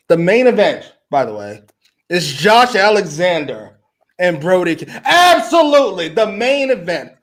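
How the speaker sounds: loud, high outraged rant; exasperated. Real, not acted. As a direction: rant in disbelief.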